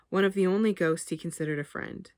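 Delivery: ordinary delivery, nothing marked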